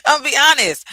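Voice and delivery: loud, high, through real laughter; laughing confession. Real, not acted. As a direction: admit it while cracking up. Confesses while laughing at herself.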